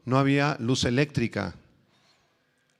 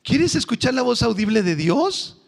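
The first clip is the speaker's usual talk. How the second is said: high, mocking reply; 13 semitones higher, 3 dB louder, slower than usual. Pastor retelling his mocking answer to the young man, high and teasing. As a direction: tease him with a high sing-song.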